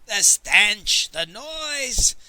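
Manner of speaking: loud, rising outburst of disgust; panted complaint. A narrator's reading. Master Lee fanning himself at the stench, exclaiming. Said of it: "Master Lee panted".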